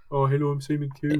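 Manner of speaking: lightly voiced talent show judge; a hint of a smug TV judge; Simon Cool impression. Joking about the X Factor judge, light mock impression. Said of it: "They've got Simon Cool".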